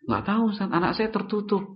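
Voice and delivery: mocking breezy parent, high and soft; imitating nonchalant parent. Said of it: "Jawabannya enak banget gitu". (menirukan orang tua menjawab santai, nada tinggi)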